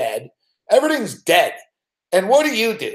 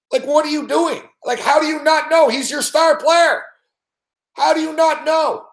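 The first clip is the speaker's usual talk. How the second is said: high-pitched, incredulous demand; real exasperation, voice riding high; exasperated disbelief; 9 semitones higher than usual. Real, not acted. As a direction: pitch it way up, baffled and indignant. Incredulous rant at the manager.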